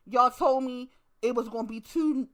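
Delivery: normal talking voice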